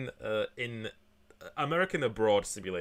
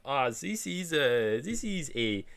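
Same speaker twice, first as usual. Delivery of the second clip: higher, drawn-out mock German accent; attempted German accent; 4 semitones higher, slower than usual. Streamer imitating Bavarian locals, attempted German accent. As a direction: try on a slow, clumsy German accent. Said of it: "how do you do a German accent".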